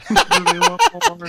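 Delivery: loud laughing repeat. Real, not acted. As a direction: burst out laughing through it. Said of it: "repeats laughing".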